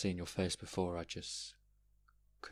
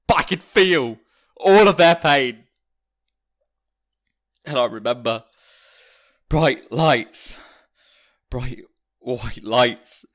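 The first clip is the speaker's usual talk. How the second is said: loud, much higher, straining as if in pain; anguished loud recounting; 8 semitones higher, 15 dB louder than usual. Acted. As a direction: relive the attack, voice climbing and loud. Narrator reliving heart attack pain.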